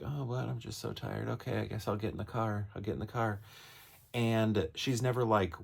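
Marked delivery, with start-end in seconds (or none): 0.0-3.4 a half-awake wife giving in, softly; sleepy wife. Retold or imitated. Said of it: "She's just like".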